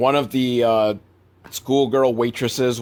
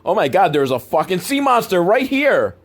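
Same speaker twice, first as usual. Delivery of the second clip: mock-alarmed outcry, higher pitch; 5 semitones higher, 3 dB louder than usual. Imagined beachgoer spotting the sea monster, mock alarm. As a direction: exclaim like someone spotting a monster.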